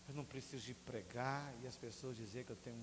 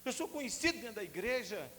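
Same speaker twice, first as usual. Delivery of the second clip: high, urgent protest; pleading with God; 12 semitones higher, 5 dB louder than usual. Retold or imitated. Young man arguing with the Spirit, high and urgent. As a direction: argue with God in a raised voice. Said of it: "Eu falei assim".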